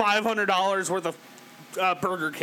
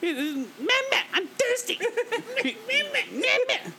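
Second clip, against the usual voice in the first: put-on high whiny man-child voice; mocking blah blah; slower than usual. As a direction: whimper for milk like a baby. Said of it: "You know".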